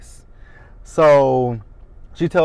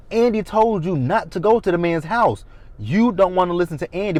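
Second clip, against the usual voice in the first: normal talking voice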